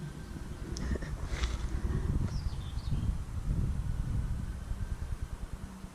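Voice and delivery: long airless chuckle; quiet wheezing laugh; breathy laugh. Real, not acted. Laughing at himself on camera, silent breathy chuckle. Laughs after admitting reluctance.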